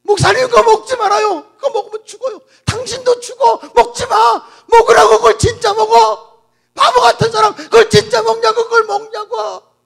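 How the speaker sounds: loud, high crying shout; woman shrieking as the pastor eats; anguished scream. Acted. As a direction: scream at him to stop. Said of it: "절규하며 소리치기".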